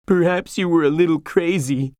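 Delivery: mild sob, pitch lifted; sobbing. Acted. Sancho kneeling by his dying master, tearful. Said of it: "Sancho sobbed".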